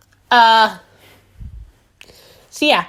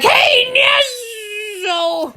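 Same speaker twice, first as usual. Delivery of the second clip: much higher, calling out to you; rival's shout; 12 semitones higher, slower than usual. Retold or imitated. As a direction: yell his name across the route. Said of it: "and he was like".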